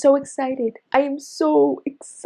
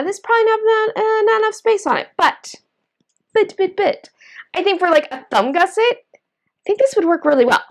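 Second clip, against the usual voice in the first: raised, uncertain musing; pitched up while hedging doubts; high voice; 8 semitones higher than usual. Real, not acted. Knitter doubting the paperclip's space, in a raised voice.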